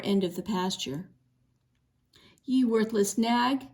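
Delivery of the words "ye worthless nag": plain reading voice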